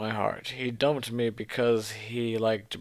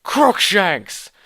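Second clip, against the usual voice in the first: much louder, higher misfired battle cry; shouted spell; 9 semitones higher, 9 dB louder than usual. Acted. Heroine shouting a botched curse at Voldemort, loud and high. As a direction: yell it like a spell.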